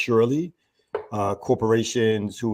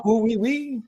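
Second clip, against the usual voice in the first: much higher, squealed "Ooh, wee, wee"; silly squeal; 14 semitones higher than usual. Retold or imitated. Host repeating his greeting to Jackie as a high squeal. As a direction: squeak it out as a goofy inside joke. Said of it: "She didn't hear my".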